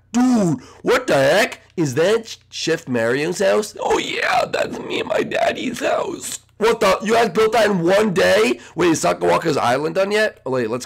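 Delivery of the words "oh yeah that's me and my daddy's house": very high, slow, airy squeal; whispery little character. Pretend. Little chef shows off his daddy's house, high airy voice.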